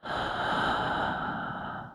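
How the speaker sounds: long whispered sigh. Real, not acted. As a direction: let out a drawn-out, voiceless sigh. Sighs during whispered ramble.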